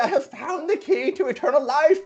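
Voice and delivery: put-on very high, frantic crazed-old-man voice; shouting old man. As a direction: shout shrilly after someone, wildly excited. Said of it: "he departs from your presence, shouting".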